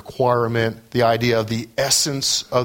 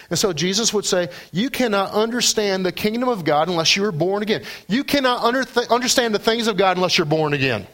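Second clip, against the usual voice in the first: raised, urgent pulpit voice; preaching; 8 semitones higher than usual. Acted. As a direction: press the warning home. Preaching Jesus' words.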